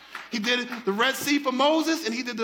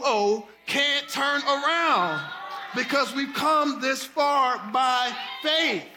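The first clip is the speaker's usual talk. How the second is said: preaching shout; 3 semitones higher, slower than usual. Real, not acted. Preacher's climactic sung shout.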